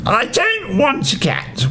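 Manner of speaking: high, sulky whine; whined. Acted. Smaragda refusing the chief witch, whining high. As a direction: whine it like a stubborn child refusing. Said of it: "whined Smaragda".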